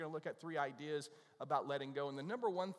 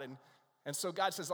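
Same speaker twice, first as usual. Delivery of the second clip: ordinary talk, nothing marked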